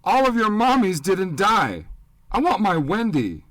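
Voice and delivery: put-on loud, high, wild child's shout; a boy shouts at the adults before running out. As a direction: yell it with a grieving boy's fury. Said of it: "Pete shouted".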